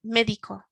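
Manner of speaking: "médico" with MÉ pushed hard; stress on the front. Shown as a demonstration. Teacher showing the stress falls on "médico"'s front. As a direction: punch the first syllable of "médico". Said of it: "the front of the word has got the strength on it".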